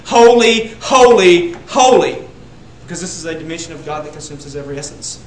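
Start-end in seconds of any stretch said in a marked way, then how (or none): 0.1-2.2 holy, holy, holy cried out high and loud; proclaimed. Shown as a demonstration. Preacher declaring what the seraphim sang, loud and high. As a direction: shout each holy to the rafters. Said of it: "they were singing".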